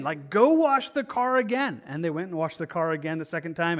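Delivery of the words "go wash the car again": mock-angry command, pitched higher and slow; angry order. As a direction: bark the order at the car washers in an imitated huff. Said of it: "he made them go and do it again".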